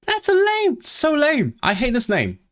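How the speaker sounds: very high-pitched fed-up whine; exasperated whine. Real, not acted. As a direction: whine in protest, voice shooting way up. Rant about disliked Pokémon name.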